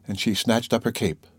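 usual speaking voice

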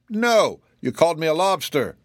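high, loud, offended objection; indignant retort. A narrator's reading. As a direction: fire back indignantly, pitched well up. Offended crab quarrels with zebra.

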